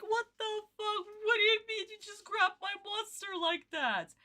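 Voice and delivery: high, indignant mock villain voice; imitating Haga. Streamer voicing Haga's outrage at losing his monster, high and indignant. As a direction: play the outraged villain whose card was stolen. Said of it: "Haga being like".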